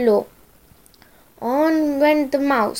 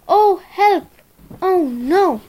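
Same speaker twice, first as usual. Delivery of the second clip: fluttering cries for help; 6 semitones higher than usual. Acted. Mouse sees the Gruffalo.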